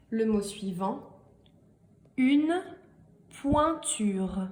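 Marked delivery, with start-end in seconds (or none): 2.2-4.5 slow, "pointure" split into syllables; dictated word. Shown as a demonstration. Teacher dictating a word three times, slow and broken up. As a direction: pause after "une", then separate each syllable of "pointure". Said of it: "je vais vous les répéter trois fois".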